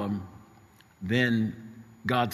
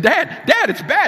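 very high, loud panicked cries; frantic; 11 dB louder than usual. Retold or imitated. Son keeps saying it to his father, frantic. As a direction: blurt it out in urgent alarm. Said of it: "Stephen called me frantic".

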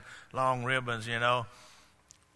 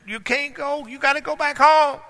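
high, insistent plea, louder toward the end; pleading with the dog; 10 dB louder than usual. Acted. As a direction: plead high-pitched with a stubborn little dog.

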